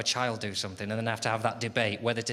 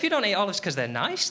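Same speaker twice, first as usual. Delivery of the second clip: usual speaking voice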